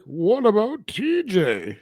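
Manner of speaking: high, slow puzzled question; clueless pilot. Retold or imitated. Pilot asks about his friend, high slow puzzled. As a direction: slowly ask after a friend, dim. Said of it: "He's like".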